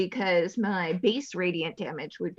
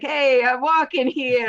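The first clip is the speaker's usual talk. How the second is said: loud, higher-pitched New York street shout; mock tough New Yorker accent; 4 semitones higher, 10 dB louder than usual. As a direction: call out like an indignant New Yorker, hammed-up. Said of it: "your mouth was trying to do a British accent, but your mind was like".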